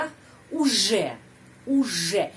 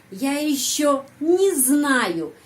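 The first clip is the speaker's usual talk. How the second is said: "не" run straight into "знаю", higher and louder, slow; не joined to знаю; 5 semitones higher, 4 dB louder, much slower than usual. Shown as a demonstration. Teacher shows how не links to the verb. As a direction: glide "не" into "знаю" without a break. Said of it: "Di sini, не sambungkan".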